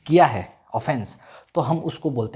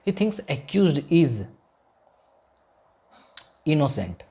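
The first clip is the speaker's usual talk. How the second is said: very slow, spaced dictation; much slower than usual. Shown as a demonstration. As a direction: stretch it out for note-taking. Teacher dictating English sentence.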